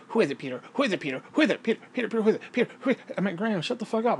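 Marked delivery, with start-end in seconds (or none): 0.1-3.1 nagging that climbs higher; imitating the neighbour nagging; nagging old woman. 3.4-4.2 higher, irritated snap; retold snap at the neighbour; snapping.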